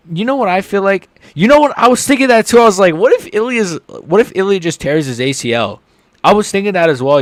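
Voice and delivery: high, loud rush of excited ideas; excited rambling. Real, not acted. Telling friends a wild injury hunch, getting excited. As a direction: blurt it out all at once.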